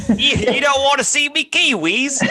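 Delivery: high mock Kiwi voice; laughing, put-on New Zealand accent. Co-host playing the rejected Kiwi girl, New Zealand accent. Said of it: "you be the New Zealand girl".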